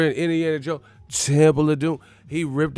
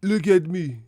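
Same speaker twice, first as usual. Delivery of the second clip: put-on loud opening bark, Somali accent; commanding Somali hijacker, word by word; mock Somali pirate; 3 semitones higher, 5 dB louder than usual. Streamer casts rival as pirate seizing ship, mock Somali accent. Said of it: "they talking like Somali pirates".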